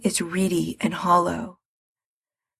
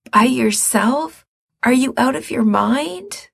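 shocked, higher question; alarmed disbelief; 6 semitones higher than usual. Acted. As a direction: react with alarm. Mikey alarmed.

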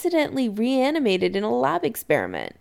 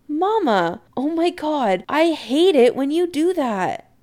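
exasperated; 7 semitones higher, 3 dB louder than usual. A narrator's reading. Startled son protests mother's sudden arrival.

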